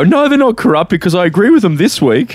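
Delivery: high, loud imitation of a protesting fan; mock protesting fan. Imitating fickle fans defending the league, high and loud protest.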